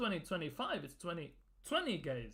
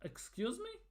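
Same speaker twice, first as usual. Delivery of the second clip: high, soft, rising disbelief; incredulous question; 8 semitones higher, 6 dB quieter than usual. Real, not acted. Fan reacting to the Norway boss's quote, incredulous. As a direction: ask it as if you can't believe your ears.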